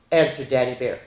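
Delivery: ordinary delivery, nothing marked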